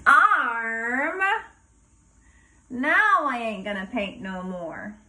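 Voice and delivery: plain reading voice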